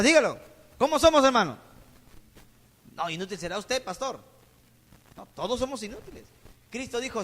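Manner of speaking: ordinary talk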